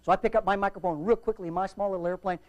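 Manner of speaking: ordinary talk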